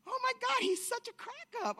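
very high, giggly squeal; tickled, voice shooting way up; laughing amazement. Retold or imitated. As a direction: reenact being cracked up by him. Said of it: "and I'm laughing. I'm like".

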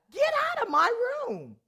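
high, loud command with a laugh; laughing but firm get-out; ordering him out. Retold or imitated. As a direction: throw him out of the room. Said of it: "and I said".

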